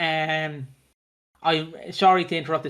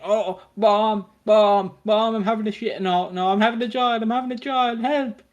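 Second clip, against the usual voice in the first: high, rising panic, higher at the end; panicked Lily; 5 semitones higher than usual. Retold or imitated. Lily calling her mum mid-labour, high mock panic. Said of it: "Lily is like".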